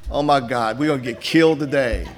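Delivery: ordinary talk, nothing marked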